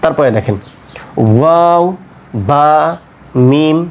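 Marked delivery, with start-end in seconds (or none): 1.4-3.8 ওয়াও, বা, মিম made with both lips; drawn-out, separated ওয়াও, বা, মিম, lips working; two-lip articulation. Shown as a demonstration. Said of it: "এই তিনটা উচ্চারণ দুই ঠোঁট থেকে উচ্চারিত হয়".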